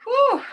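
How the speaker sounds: a big, high, loud exhale of being overwhelmed; emotional whew. Real, not acted. Overwhelmed by moving testimony.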